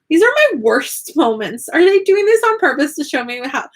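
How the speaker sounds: high-pitched exasperated protest; mock indignation. Retold indignant reaction to own video.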